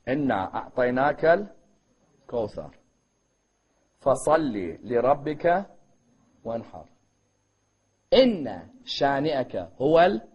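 low, measured, halting recitation; verse in broken groups. Shown as a demonstration. Teacher shows listeners the number of syllables, verse in broken groups. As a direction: recite in separate chunks with long pauses between.

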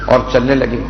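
measured, mournful majlis recitation; masaib recitation. Acted. Reciter telling the Kufa story to mourners, mournful. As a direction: chant the tragedy to the mourners.

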